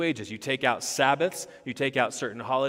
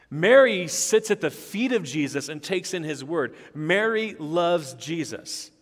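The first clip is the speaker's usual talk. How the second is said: raised, louder pulpit delivery; impassioned preaching; 5 semitones higher, 7 dB louder than usual. Real, not acted. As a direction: preach it with rising force, driving home each point.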